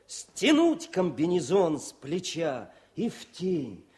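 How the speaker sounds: slow, measured verse reading with pauses; poem recital. Acted. Officer reads the tank poem to raise a wounded tankman's spirits.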